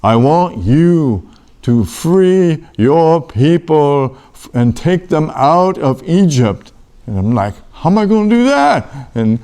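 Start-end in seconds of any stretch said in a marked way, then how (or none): 0.0-6.7 slow, measured divine command; voice from the bush. Acted. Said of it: "And the voice said". 7.7-9.1 high-pitched disbelieving question; incredulous protest. Acted. Acted protest to the voice.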